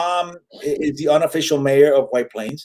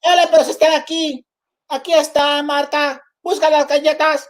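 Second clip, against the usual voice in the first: high, singsong copied Spanish dialect; mom's borrowed dialect; 11 semitones higher than usual. Acted. Mother answering cookie buyers, high borrowed Spanish dialect. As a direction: mimic Mom echoing a caller's Spanish accent. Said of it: "take that and she'd be like".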